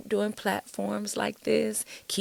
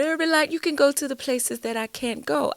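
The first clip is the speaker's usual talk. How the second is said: put-on Trinidad accent, a bit higher and more sung; 6 semitones higher, 4 dB louder than usual. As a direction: imitate the pastor in a Trinidad lilt. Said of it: "that's my Trinidad, um, accent".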